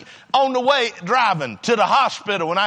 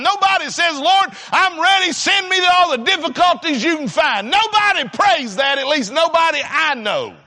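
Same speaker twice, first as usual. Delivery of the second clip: raised, emphatic pulpit delivery; full-force preaching; 4 semitones higher, 3 dB louder than usual. Real, not acted. Preacher telling congregation nobody prays for hard times, impassioned preaching. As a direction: preach it out with rising pitch and punched key words.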